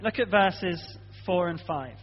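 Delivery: ordinary talk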